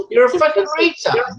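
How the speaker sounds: normal talking voice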